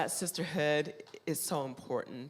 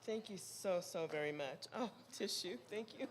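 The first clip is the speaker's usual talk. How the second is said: softer, higher, teary murmur; tearful thanks; 3 semitones higher, 10 dB quieter than usual. Real, not acted. Speaker taking a tissue from the crowd, tearful. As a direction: thank them while holding back tears. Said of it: "I got tears in my eyes".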